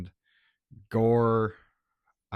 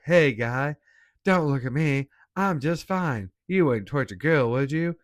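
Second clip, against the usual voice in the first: louder, higher cartoon zombie; hammed-up talking zombie in South Park style; 5 semitones higher, 5 dB louder than usual. Reviewer imitates the zombie pleading not to be looked at, South Park voice. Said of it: "like, full on South Park".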